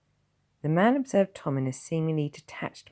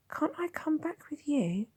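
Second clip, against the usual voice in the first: put-on pleading boy. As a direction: beg him to take you home. Will pleads.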